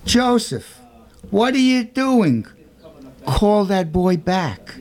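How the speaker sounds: high, ringing call across the church. Acted. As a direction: call Joseph back firmly, slowly at the end. Priest calls to sexton.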